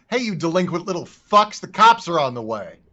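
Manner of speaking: put-on loud yell at the kids; shout. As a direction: bark a warning across the alley. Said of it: "Romney will shout".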